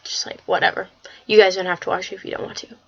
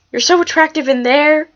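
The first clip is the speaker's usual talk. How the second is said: higher, louder fangirl gush; excited gushing; 6 semitones higher, 5 dB louder than usual. Real, not acted. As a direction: gush as if the comic came alive. Gushing over cartoon come alive.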